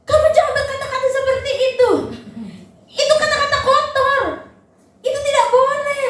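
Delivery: high, stern mock scolding of a toddler; scolding parent. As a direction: tell off the child in a high preachy voice. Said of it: "kita omelinnya gini".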